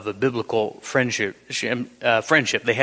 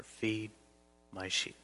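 much softer, gentle and forgiving; tender, merciful; 2 semitones lower, 15 dB quieter than usual. Acted. Jesus answering Peter's plea, tender and merciful. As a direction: reassure a friend who has failed you. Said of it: "Jesus tenderly, mercifully says".

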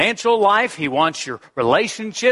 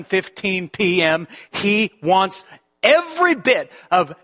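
higher, building pulpit cry; fervent preaching; 6 semitones higher than usual. Acted. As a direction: preach it, climbing with each line. Pastor rising into fervent preaching.

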